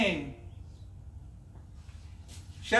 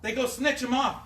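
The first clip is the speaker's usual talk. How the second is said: usual speaking voice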